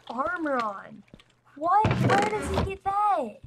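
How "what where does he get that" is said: startled, higher and louder; shocked disbelief; surprised. Real, not acted. Shocked at enemy's armor.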